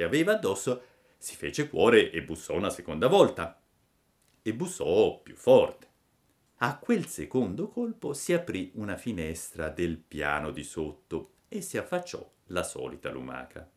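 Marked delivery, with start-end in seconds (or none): none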